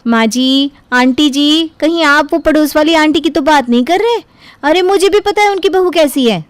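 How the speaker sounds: high, eager interrupting voice; nosy daughter-in-law butting in. Acted. Daughter-in-law barging into the elders' talk, eager and high. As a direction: cut in brightly with gossip, pitch climbing. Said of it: "बीच में बोल पड़ती है".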